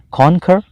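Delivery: teacher models कॉन्कर, dropping the w; correct pronunciation. Shown as a demonstration. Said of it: "इसका सही pronunciation बन गया कॉन्कर".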